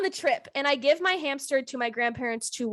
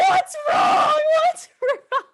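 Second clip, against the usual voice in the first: alarmed mom; 14 semitones higher, 4 dB louder, much slower than usual. Retold or imitated.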